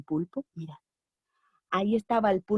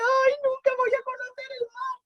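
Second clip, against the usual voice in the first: put-on much higher, wailing like a child; hammed-up crying. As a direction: wail it like a kid who lost her ice cream. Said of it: "Y lloraba y lloraba mientras decía".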